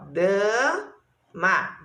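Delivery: dama split in two, long da, then ma; syllable by syllable. Shown as a demonstration. Teacher asking how many syllables, syllable by syllable. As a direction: hold the first syllable of dama, pause, then ma. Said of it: "Quantas sílabas tem essa aqui".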